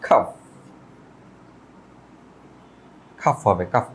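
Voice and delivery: খাফ with no L before F; silent L. Shown as a demonstration. Teacher answers what it will be, silent L. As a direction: breathe out the K, then long vowel in খাফ. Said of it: "এখানে এল এর উচ্চারণ হবে না".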